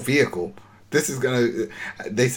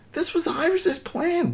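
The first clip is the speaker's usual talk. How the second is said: much higher and slower, stressing Iris's; incredulous repeat; slower than usual. Real, not acted. Podcast host protesting the scene to Rich, incredulous and high. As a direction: drag out this was Iris's plan in disbelief.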